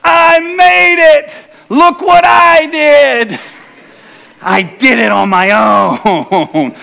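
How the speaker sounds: slow, high, hammed-up bragging; mock boasting. Pastor mocking a rescued swimmer bragging on the shore, hammed-up boast. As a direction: crow about it like a self-made hero. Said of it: "I did not walk up on the shore and go".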